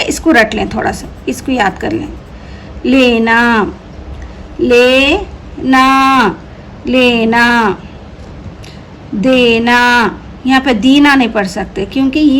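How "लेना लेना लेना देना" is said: very slow, syllable by syllable; slow drawn-out reading. Shown as a demonstration. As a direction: stretch लेना out slowly for learners. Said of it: "teacher stretches the word for pupils".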